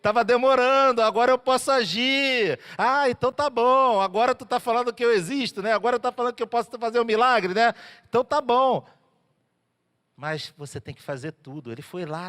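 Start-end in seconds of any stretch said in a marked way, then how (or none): 0.0-1.0 louder, higher repeat; eager God. Acted. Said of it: "Aí Deus". 1.0-8.8 louder, higher, delighted reply; relieved it finally came, ready to act; eager God. Acted. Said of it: "Aí Deus".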